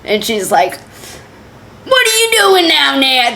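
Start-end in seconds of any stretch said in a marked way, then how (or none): 1.8-3.3 hammed-up New York neighbor, pitched way up; bad New York accent. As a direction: put on a badly faked New York accent. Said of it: "That's a really bad accent".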